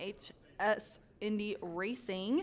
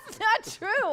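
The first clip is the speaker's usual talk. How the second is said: laughing protest; 16 dB louder than usual. Real, not acted. Laughing protest at co-host's joke.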